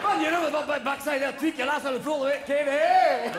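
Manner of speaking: loud, high Scottish gibberish; incomprehensible Scottish. Acted. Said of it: "you can't fucking understand them".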